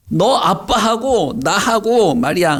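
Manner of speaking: high, slow, drawn-out telling-off; scolding mother. Acted. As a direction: drag the words out like an exasperated mother. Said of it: "이렇게 말했어요. 엄마 마리아가".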